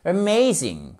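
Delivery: "Amazing" opening on a tiny uh; schwa in AMAZING. Shown as a demonstration. Teacher demonstrating the schwa, "Amazing" as uh-MAY-zing. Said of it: "E renversé comme ceci".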